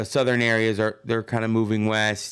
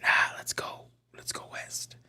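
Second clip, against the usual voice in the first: hushed, voiceless imitation of travelers; whispered play-acting; mock breezy whisper; 7 dB quieter than usual. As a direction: toss it off in a mock whisper, fading away. Acting carefree travelers setting off.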